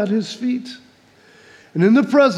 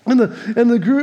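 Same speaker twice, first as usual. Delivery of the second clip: ordinary delivery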